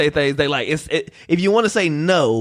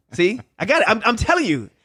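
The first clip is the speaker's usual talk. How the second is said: ordinary talk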